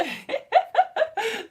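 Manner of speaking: high burst of staccato laughter. Real, not acted. Host putting theme park ideas to the test, high laugh.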